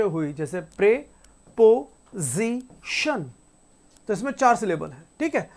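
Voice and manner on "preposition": PREPOSITION said very slowly in four separate beats; broken into syllables. Shown as a demonstration. Teacher counting syllables for students, preposition split syllable by syllable. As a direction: pause between each syllable of PREPOSITION. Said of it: "बोलते वक्त हमारी जबान चार बार आगे पीछे".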